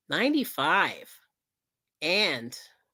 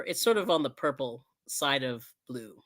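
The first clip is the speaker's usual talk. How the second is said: normal talking voice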